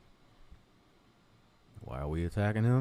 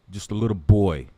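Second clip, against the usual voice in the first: louder, scornful jab; loud taunt; 8 dB louder than usual. Real, not acted. Vlogger taunts Talib as a little boy, loud and scornful. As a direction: belt out the put-down.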